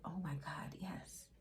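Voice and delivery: breathless whisper of delight; whispered bliss. Retold or imitated. Someone overwhelmed by a magical spring, whispered. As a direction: whisper it like you can't believe it.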